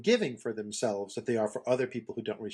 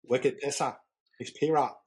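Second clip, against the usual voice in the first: mock Boston accent; 3 semitones higher, slower than usual. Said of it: "Boston accent".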